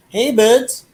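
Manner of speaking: high cartoon greeting; cartoon character voice. Quoting the Baby Yoda video, high cartoon voice. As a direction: chirp it like a little cartoon guy. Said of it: "when he says".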